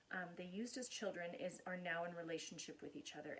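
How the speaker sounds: ordinary talk, nothing marked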